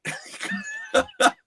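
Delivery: sudden high-pitched laugh. Real, not acted. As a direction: burst out laughing. Laughs at movie quote.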